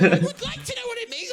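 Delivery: very high-pitched mum impression; loud, squeaky mock demand; insistent mum. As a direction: imitate a mother piping up insistently. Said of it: "And my mum went".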